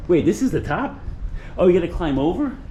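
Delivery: high surprised question; real disbelief at the top. Real, not acted.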